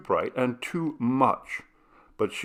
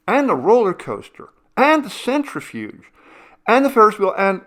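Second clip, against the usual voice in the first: rising higher and louder with each ride; excited list; 11 semitones higher, 9 dB louder than usual. Acted. Cindy rattling off every ride to her sister, gleeful. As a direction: pile them on, ever more thrilled.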